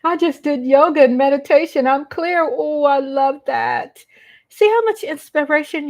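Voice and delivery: beam as you read it out; delighted. Real, not acted. Reading a viewer's comment.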